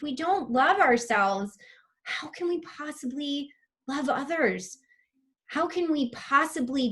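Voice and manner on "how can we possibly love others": unvoiced, hushed whisper. Real, not acted. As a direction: lean in and breathe the words. Intimate point in her talk.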